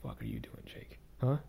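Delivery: harsh self-scolding whisper, nearly voiceless; muttered self-criticism in a whisper; whispering to himself. Retold or imitated. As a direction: hiss the insult at yourself under your breath. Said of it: "I might whisper to myself".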